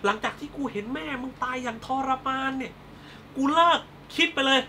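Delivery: hammed-up melodramatic gloating; Thai soap heroine. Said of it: "ทำตัวเป็นนางเอกละครไทย".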